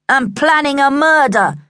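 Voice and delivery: teasing, provocative shout; winding her up. A narrator's reading. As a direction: pile it on to goad a sister. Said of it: "Luke tried to wind her up".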